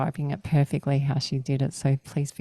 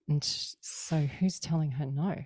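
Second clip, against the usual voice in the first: usual speaking voice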